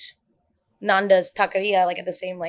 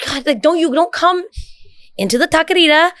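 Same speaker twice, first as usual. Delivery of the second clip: higher, louder annoyed scolding; retold rant in the taqueria, part Spanish; mock angry rant; 7 semitones higher, 7 dB louder than usual. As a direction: vent at the people blocking the line. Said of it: "I'm so mad".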